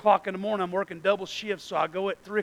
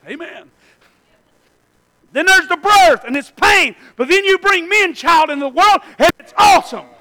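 loud, high, building sermon voice; preaching at full force; 9 semitones higher, 16 dB louder than usual. Acted. Pastor preaching to the church at full force. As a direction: drive it home to the congregation.

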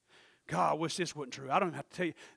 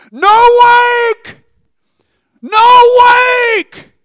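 much higher and louder warning shout; hollering; 29 dB louder, much slower than usual. Retold or imitated. People on the dock yelling at the reckless boat driver. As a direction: yell it across the water at a speeding boat. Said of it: "I hear these people hollering".

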